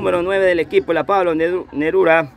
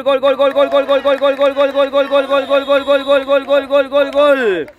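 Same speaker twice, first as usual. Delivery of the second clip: echoing stadium announcer; 7 semitones higher, 3 dB louder than usual. Real, not acted. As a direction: belt out the goal call, high and loud. Gol cantado en narración. (grito de gol prolongado y agudo)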